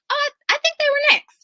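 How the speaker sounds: high, quick, mock-polite voice; polite bystander speaking up. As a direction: chirp it out as an overly polite bystander. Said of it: "that's the time to be like".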